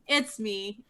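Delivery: ordinary delivery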